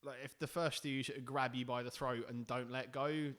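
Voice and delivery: ordinary talk, nothing marked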